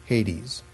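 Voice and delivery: "Hades" as HAY-deez, two syllables; proper Greek pronunciation. Shown as a demonstration. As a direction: pronounce "Hades" with a final "deez". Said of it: "as I think it is properly pronounced".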